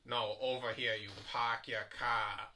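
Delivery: a bad Boston accent, drawn out. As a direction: imitate the mayor's thick Boston accent. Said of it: "that really bad Boston accent".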